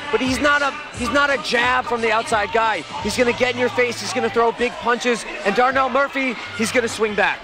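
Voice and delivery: ordinary talk, nothing marked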